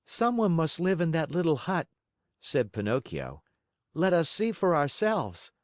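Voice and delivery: put-on high, eager boy; curious puppet boy. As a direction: peek at a hut with curiosity. Pinocchio curious.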